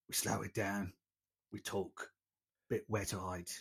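a sketchy, self-mocking attempt at an elderly patrician actor; patrician Michael Caine. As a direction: sink into a soft, teary old-gentleman voice. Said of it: "that was terrible".

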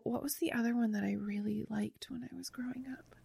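hushed, near-whispered thinking aloud; muttered aside. Real, not acted. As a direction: drop to a soft whisper, asking yourself. Muttering to self while recalling.

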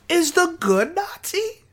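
sudden squeaky crack on the last word; voice cracking up an octave. Real, not acted. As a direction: voice cracks sharply upward. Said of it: "Sorry, my voice cracked hard".

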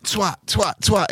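high-pitched repeated jabs; mock kid acting out whacks; re-enacted blows. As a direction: punch out each word high, imitating the hits. Re-enacts hitting a lad while retelling.

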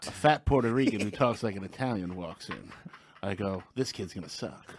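noir narrator. Pretend.

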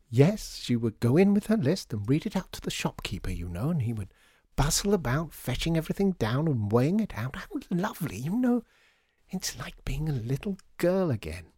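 high, swooping, delighted lady; gushing lady customer. Acted. Lady customer remembering childhood shops, gushing. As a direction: gush nostalgically with swoops up high. Voices Missus Mountjoy reminiscing.

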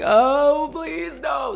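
high mock pleading; lightly hammed-up despair; exasperated plea. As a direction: beg with exaggerated dismay. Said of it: "I was like".

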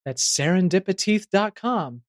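ordinary talk

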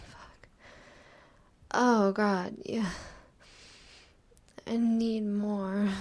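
ordinary delivery

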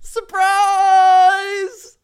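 squealing, high surprise shout; excited surprise. Real, not acted. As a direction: burst out with delight. Springs costume surprise.

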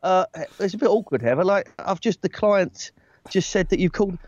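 meek, halting imitation of a younger self; awkward and too soft. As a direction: raise it apologetically, stumbling over the words. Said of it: "Back then I was like".

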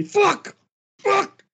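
high, loud furious scream. Retold or imitated. Imagined manager raging in the dressing room, screaming. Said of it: "see him screaming".

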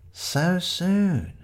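high, lightly voiced young man; Shiloh tasting the clue; Shiloh's musing. A narrator's reading. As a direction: turn the words over thoughtfully. Shiloh repeats it slowly.

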